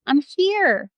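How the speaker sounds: high, mock holler from below; hollering back. Lost Jo answering her rescuer from the well, hollering back. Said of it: "and she hollers back".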